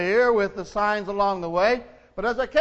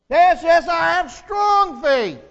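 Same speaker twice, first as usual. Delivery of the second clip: much higher, louder, pleading cry; desperate plea; 8 semitones higher, 7 dB louder, slower than usual. Acted. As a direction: beg for rescue, panicked. Tourist eagerly affirms faith.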